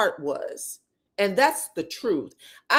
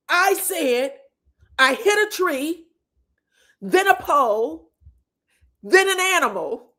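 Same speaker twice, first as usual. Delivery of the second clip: high, louder, item-by-item delivery; retold indignant list; 6 semitones higher, 4 dB louder than usual. Retelling Maurice listing his crash, high and indignant. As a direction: lay out each mishap with pauses, voice raised.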